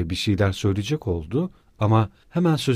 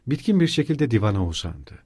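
ordinary delivery

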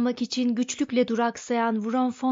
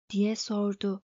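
usual speaking voice

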